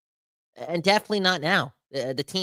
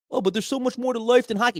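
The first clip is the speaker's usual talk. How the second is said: high mocking voice; mimicking the player's excuse, high and mocking; mocking whine; 8 semitones higher than usual. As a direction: sneer out the excuse.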